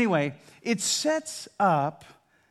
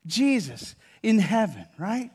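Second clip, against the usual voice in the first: ordinary talk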